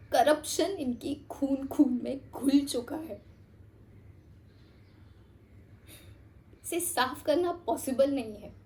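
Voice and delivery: soft, slow, heavy admission; grave resignation. Acted. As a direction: let it sink in quietly, without hope. Bleak admission that corruption is incurable. (धीमी, गंभीर, हताश आवाज़)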